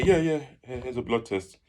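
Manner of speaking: acting fine for the nurse; feigned composure. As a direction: hide the tears behind a casual yes. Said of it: "These times I'm hiding the tears".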